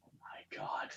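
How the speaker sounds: breathless whisper. Real, not acted. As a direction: whisper it in disbelief. Stunned reaction to crude joke.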